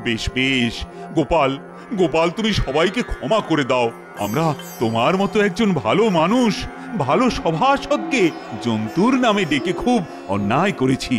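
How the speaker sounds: low-pitched, gentle royal coaxing; soothing apology. Acted. King begging Gopal's forgiveness, soothing. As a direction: coax him back kindly in a deep voice.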